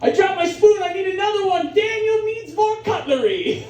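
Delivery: high, hammed-up plea for service; needy Daniel. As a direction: call out like an overexcited helpless customer. Acts as needy Daniel calling server.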